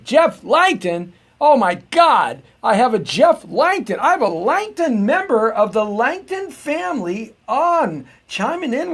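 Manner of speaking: loud, high, slow-building astonishment; amazed excitement. Real, not acted. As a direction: exclaim it high and loud, thrilled by the name. Amazed that a Langton checked in.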